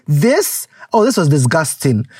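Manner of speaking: ordinary talk, nothing marked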